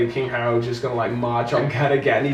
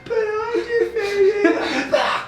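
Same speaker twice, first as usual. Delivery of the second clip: mock dying hero. Mocking character's dying speech.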